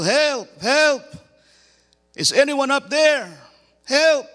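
high, loud cries for help; panicked yell. Acted. Hiker hanging from a branch calls upward, panicked yelling. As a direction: yell up the cliff, desperate. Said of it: "starts to yell".

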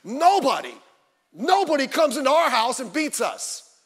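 loud mock pep-talk yell; retold coach's yell. Preacher imitating Rudy's coach rallying all the players, mild yell. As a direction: punch it out like a coach, moderately loud. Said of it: "He starts yelling and screaming".

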